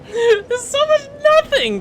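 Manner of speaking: high, slow, laughing through the words. Real, not acted. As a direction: dissolve into laughter mid-line. Laughing about empty movie.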